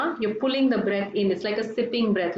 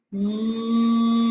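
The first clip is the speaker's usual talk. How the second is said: firm closed-mouth hum; humming sound; 4 dB louder than usual. Shown as a demonstration. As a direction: hum steadily through closed lips. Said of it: "Roll your tongue back, close your mouth".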